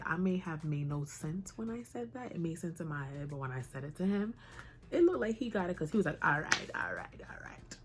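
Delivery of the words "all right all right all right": a hushed, breathy "all right" from the barber; barber whisper. Retold or imitated. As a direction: mutter it under your breath like a calm barber. Barber agreeing while cutting hair.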